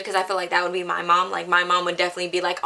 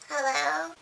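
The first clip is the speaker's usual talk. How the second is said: higher, softer fake just-woke-up hello; fake sleepy; 7 semitones higher, 7 dB quieter than usual. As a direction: pretend you were fast asleep. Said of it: "trying to act all fucking sleepy".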